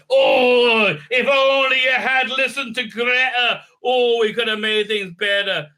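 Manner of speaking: high, loud, hammed-up sermon; mock preachy lecture. Hero lecturing the boy about Greta, mock shrill preaching. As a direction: overplay the sanctimonious plea.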